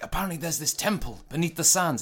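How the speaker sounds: high-pitched, hammed-up film exposition; mock adventurer. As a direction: announce a legendary quest with mock wonder.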